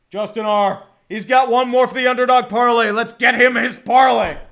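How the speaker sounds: loud, hyped announcer call; hyped shout. Real, not acted. Streamer cheering on a viewer's underdog parlay, hyped shout.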